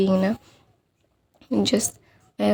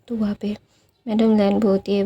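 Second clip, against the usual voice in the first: usual speaking voice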